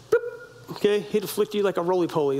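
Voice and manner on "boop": squeaky high boop; playful boop. Retold or imitated. Preacher mimics God flicking a man, high boop. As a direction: pop it up high and light. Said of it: "He'd have just went".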